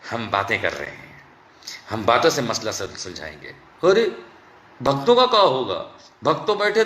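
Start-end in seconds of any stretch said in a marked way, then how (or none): 0.1-3.5 mocking the government. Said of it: "और अब क्या बोलते हैं".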